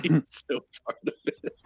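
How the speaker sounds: ordinary delivery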